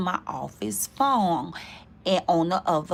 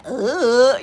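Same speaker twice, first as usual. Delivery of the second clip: louder mock disgusted groan; disgusted ugh; 5 semitones higher, 6 dB louder than usual. Rejecting him again, mock disgusted groan. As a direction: scoff it with disgust.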